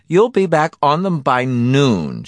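high, airy wife voice, drawn out; airy proclamation. Acted. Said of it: "she proclaimed airily".

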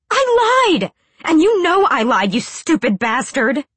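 high, loud furious yell; furious outburst. A narrator's reading. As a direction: yell it at him. Angry outburst.

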